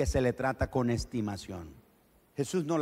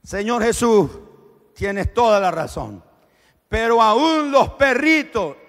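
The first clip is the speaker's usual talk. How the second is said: pleading answer; 6 semitones higher, 9 dB louder than usual. Acted. Woman answering Jesus humbly.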